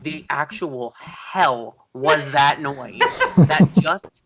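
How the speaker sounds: slow, stammering incredulity through laughter; baffled disbelief. Retold or imitated. Guest reacting to a character's bizarre noise, acted bafflement. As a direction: stretch the words out, lost for words.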